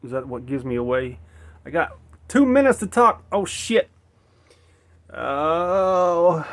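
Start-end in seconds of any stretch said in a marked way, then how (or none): 1.7-6.4 sudden high, loud burst of surprise; jolted, high-pitched exclamation; startled alarm. Real, not acted. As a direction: blurt it out loudly, caught out by the time. Sudden realisation that time is short.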